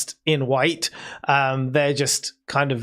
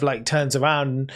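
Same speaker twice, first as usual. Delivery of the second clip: usual speaking voice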